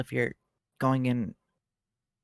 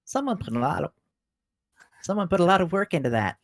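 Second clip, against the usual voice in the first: higher-pitched put-on voice; attempted character voice; 6 semitones higher, 4 dB louder, faster than usual. Player retrying the line in character, straining for the higher voice. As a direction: strain upward for the character's voice, not quite getting there. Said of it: "I can't do my voice right now".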